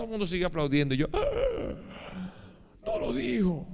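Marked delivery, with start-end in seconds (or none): 1.1-3.7 high-pitched mock wail; comic, high lament at being left out; dismayed outcry. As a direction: cry out in acted dismay. Retold dismay at not being credited.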